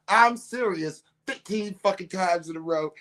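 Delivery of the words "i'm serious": mild mock yell. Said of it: "I'm screaming".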